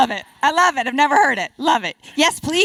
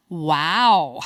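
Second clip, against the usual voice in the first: low wow; 7 semitones lower than usual. Shown as a demonstration. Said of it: "you can deliver it a million different ways".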